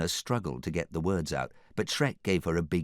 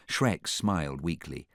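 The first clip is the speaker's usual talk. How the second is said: ordinary delivery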